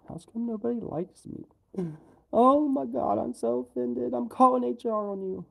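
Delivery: high, loud hammed-up huff; mock offended whine. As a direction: play fake outrage, high and loud.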